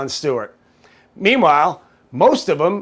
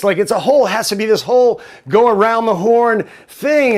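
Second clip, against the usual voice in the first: ordinary talk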